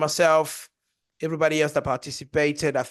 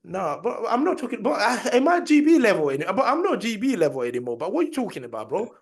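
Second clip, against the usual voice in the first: laughing, high-pitched denial; laughing protest; 6 semitones higher, 4 dB louder than usual. Real, not acted.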